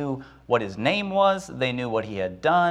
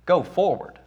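a hint of Moses echoing the order in disbelief, pitched higher; incredulous echo; 5 semitones higher than usual. Moses repeats God's command back, higher and baffled. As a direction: repeat the order as if you can't believe it. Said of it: "He says".

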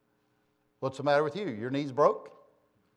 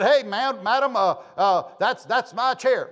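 mock loud complaint; 8 semitones higher, 10 dB louder, slower than usual. Member claiming his seat.

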